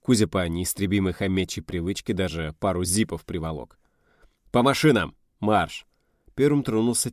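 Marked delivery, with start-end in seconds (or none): 4.5-5.9 loud military command; raised commanding call; barked order. Acted. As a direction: send the squad off, loudly. Officer orders the squad out.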